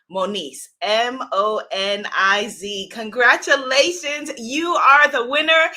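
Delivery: ordinary delivery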